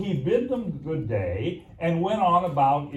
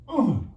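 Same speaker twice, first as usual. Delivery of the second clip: mimicked grunt; 9 semitones higher, 5 dB louder than usual. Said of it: "you go".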